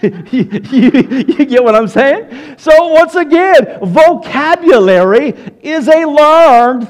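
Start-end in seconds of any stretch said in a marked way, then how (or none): none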